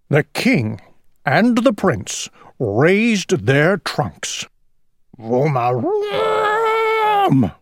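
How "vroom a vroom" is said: long, very high trumpet blast; squealing elephant trunk call. A narrator's reading. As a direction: imitate elephants trumpeting, drawn out. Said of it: "trumpeted as loudly as they could".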